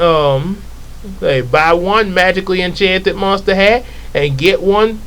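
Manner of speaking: normal talking voice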